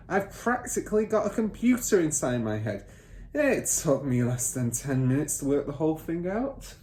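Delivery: lightly voiced smug car dealer father; boastful father. Father bragging to son about cheating buyers, lightly smug.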